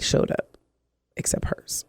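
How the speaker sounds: breathy aside, no voice; hushed aside; whispered. Real, not acted. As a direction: drop to a whisper for emphasis. Whispered detail in story.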